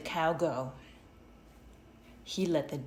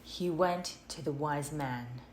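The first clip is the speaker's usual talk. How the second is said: ordinary delivery, nothing marked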